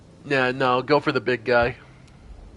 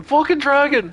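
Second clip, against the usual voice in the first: high, loud imitation of a panicked scream; mock scream; 11 semitones higher, 5 dB louder than usual. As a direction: mimic a fleeing enemy's cry. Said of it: "He's running away screaming".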